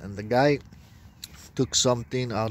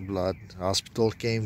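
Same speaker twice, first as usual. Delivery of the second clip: ordinary delivery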